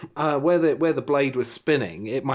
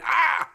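pained yelp; 3 dB louder than usual. Retold or imitated. Electric shock recalled, cry.

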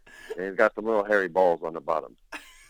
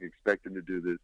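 ordinary delivery

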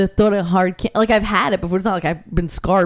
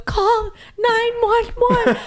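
high, slow, hammed-up panic; mock panicked customer; slower than usual. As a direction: imitate a drama queen begging. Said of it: "he goes".

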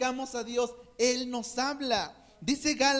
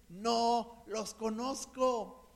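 very slow, grave rejection; slow, solemn divine verdict; slow solemn Jesus; 4 dB quieter, much slower than usual. Retold or imitated. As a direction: let each word fall slowly and heavily.

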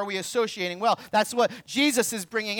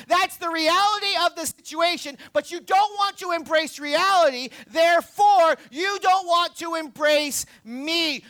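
full-force preaching; 7 semitones higher than usual. Acted. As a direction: preach it out hard to the crowd.

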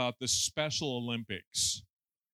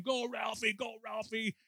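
higher mock cheer for a runner; cheering parent; 8 semitones higher than usual. Parent cheering a boy on the track, excited call.

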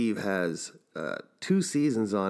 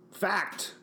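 squeaky declaration; 9 semitones higher, 4 dB quieter than usual. Pretend. Running bit.

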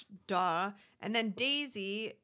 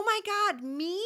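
high squealing fake surprise; mock flattered shock; 12 semitones higher, 6 dB louder, slower than usual. Imitating Amber's surprise at attention, high squeal. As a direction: gush "my God, me?" like a fake-innocent girl. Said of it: "she's just like".

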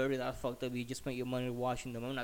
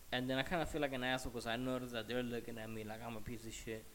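ordinary delivery

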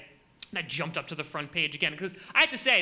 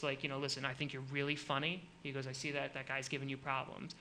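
softer, low confiding tough-guy voice; hushed biker; 5 semitones lower, 11 dB quieter than usual. Retold or imitated. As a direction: lean in and confide like a big biker. Big biker guy confiding at bar.

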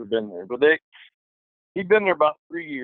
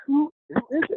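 freaked-out question; 12 semitones higher than usual. Retold or imitated. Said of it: "they're freaking out".

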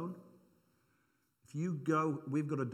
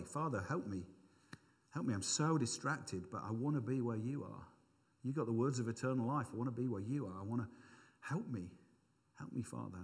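quiet plea; 2 semitones lower, 4 dB quieter than usual. Shown as a demonstration. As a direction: murmur a humble plea to the Father. Example of praying it out.

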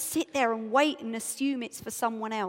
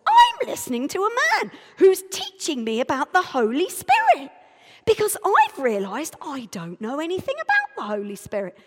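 loud, high, indignant retort; getting high-pitched; 3 semitones higher, 8 dB louder than usual. Acted. Telling a stranger at Waterloo what matters, high-pitched and worked up. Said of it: "I'm getting all high-pitched, just like I was then".

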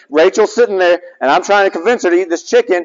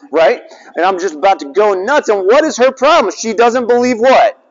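ordinary delivery

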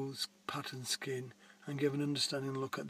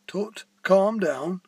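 louder, slow mock-stern call; drawn-out, louder reassurance from the warden; 4 semitones higher, 10 dB louder, much slower than usual. Park warden tells worried Ursula not to fret, slow and loud.

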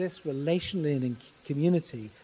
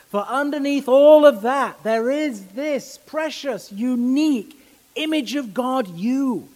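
louder and higher, slowing on precious; impassioned climax; 5 semitones higher, 6 dB louder than usual. Acted. Preacher telling congregation they bear God's image, impassioned and louder. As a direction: swell up with conviction over the congregation.

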